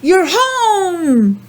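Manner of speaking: very high, happy welcome; little penguin voice. Acted. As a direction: greet a friend coming home. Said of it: "he's saying".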